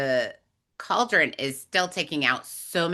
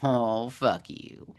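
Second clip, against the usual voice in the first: slow mock translation; 2 semitones lower, much slower than usual. Shown as a demonstration. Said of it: "that's a Southern girl's way of saying".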